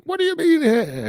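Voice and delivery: high, loud mock outrage; mock indignant disbelief. Imitating Gus answering the hair complaint, indignant and high-pitched. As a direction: squawk it back like an offended cook. Acting out Gus's indignant reply.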